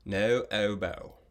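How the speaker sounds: no oboe, slow, rounded British accent. Shown as a demonstration. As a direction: draw the lips in and say no oboe the British way. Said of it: "with a fancy British accent, like this".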